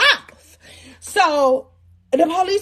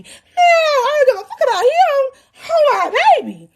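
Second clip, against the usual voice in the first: high, drawn-out hammed-up wail; mock wailing; 11 semitones higher than usual. Mother told DCF is taking her baby, mock wail.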